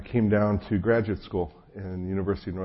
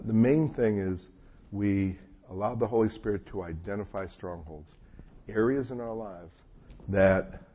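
normal talking voice

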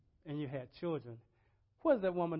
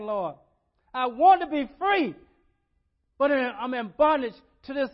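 loud, high impassioned plea; crying out to God; 8 semitones higher, 13 dB louder than usual. Acted. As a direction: cry out to the Lord as if desperate for freedom. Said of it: "unless we cry out to God".

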